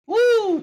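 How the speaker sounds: loud, high "woo"; astonished whoop. Real, not acted. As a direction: let out a big high whoop of disbelief. Host reacts to crazy story.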